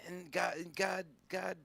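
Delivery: usual speaking voice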